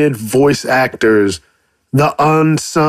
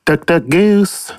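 cartoon voice; 5 semitones higher than usual. Playing voices, cartoon game chant.

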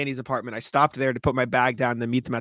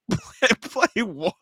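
confused owner; 7 semitones higher, much slower than usual. Retold or imitated. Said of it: "he's just like".

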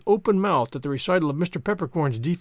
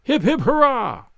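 cheering; 7 semitones higher, 5 dB louder, slower than usual. Acted. Company cheers a toast.

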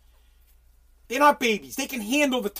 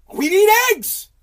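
higher, louder indignant cry; mock outraged demand; 10 semitones higher, 7 dB louder than usual. Mocking a leader demanding eggs, a much higher, louder indignant cry. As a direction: insist on it like a spoiled child.

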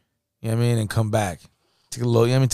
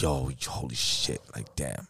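low muttered dismay; 10 semitones lower, 13 dB quieter, slower than usual. Retold or imitated. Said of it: "I'm like".

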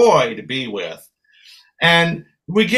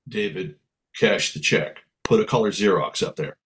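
big brother voice; 7 semitones lower, 4 dB quieter than usual. Retold or imitated. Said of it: "typical big brother, he says, quote".

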